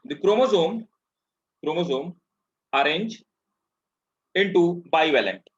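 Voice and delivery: slow, word-by-word dictation voice. Acted. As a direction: dictate each phrase slowly for notes. Teacher dictating notes slowly.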